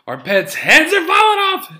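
high-pitched hysterical movie yell; loud, over-the-top panic; movie quote impression. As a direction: shriek it like a frantic comedy character. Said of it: "that's for people who don't know that one".

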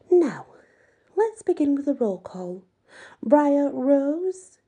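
put-on high, bright formal greeting to the children; prim teacher voice. A narrator's reading. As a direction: sing it out like a prim 1950s hostess. Madame calling roll.

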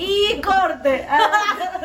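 loud, high laughing call. Real, not acted. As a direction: call cut while laughing. Mock director ending scene. (gritando riéndose)

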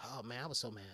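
normal talking voice

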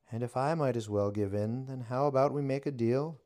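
high, loud mock-villain witch. A narrator's reading. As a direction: play it pitched high and loud. Witch voice held.

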